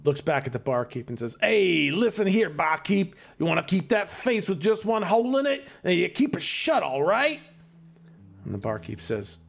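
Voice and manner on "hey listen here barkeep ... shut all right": put-on tough-guy Chicago accent, pitched up; menacing thug in a Chicago accent. Barkeep threatened in a Chicago gangster accent. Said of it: "The one in the Chicago accent".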